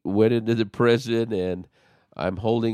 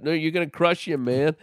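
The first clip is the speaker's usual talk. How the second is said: much higher, alarmed inner cry; alarmed thought; 9 semitones higher than usual. Retold or imitated. Watching David squeeze the baby, high mock alarm. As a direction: spike the pitch in mock alarm.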